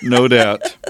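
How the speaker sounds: laughing. Real, not acted. Laughing at a joke.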